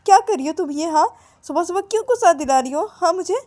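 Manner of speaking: high, irritated telling-off; angry scolding. Acted. Girl snapping at her friend in the morning, angry scolding. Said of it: "इतना गुस्सा क्यों कर रही हो".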